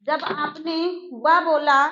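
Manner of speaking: usual speaking voice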